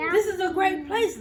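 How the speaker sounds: loud, high cheerful shout. Acted. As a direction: shout it across the pool hall.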